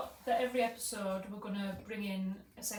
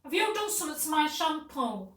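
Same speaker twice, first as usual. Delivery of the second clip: high, loud imitation of an accuser; acted accusation; 6 semitones higher, 9 dB louder than usual. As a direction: demand an answer in mock outrage. Retold accusation over shampoo.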